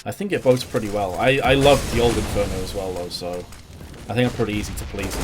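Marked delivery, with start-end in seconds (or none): none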